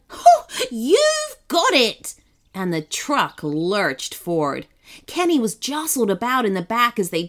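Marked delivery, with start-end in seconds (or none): 0.1-2.1 high, loud, drawn-out sloth whoop; gleeful holler. A narrator's reading. Sloth told to drive faster, gleeful holler. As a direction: holler back, thrilled to oblige. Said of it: "hollered Sebastian".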